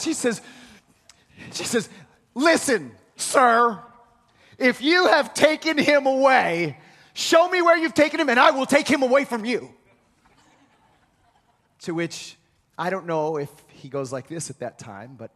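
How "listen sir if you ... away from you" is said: higher and louder, upset and insistent; upset demand. Retold or imitated. Mary to gardener Jesus, upset and demanding. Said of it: "she gets upset".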